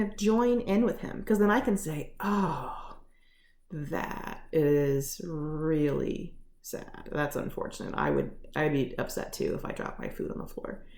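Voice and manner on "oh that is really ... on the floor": sympathetic. Acted. Wife consoling husband who dropped his food, sympathetic. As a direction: gently join in with their upset.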